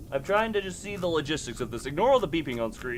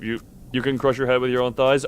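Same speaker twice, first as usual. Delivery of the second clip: ordinary delivery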